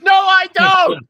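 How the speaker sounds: high, slow shouted denial; playful shout. Real, not acted. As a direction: shout it slowly, joking. Joking denial after swearing.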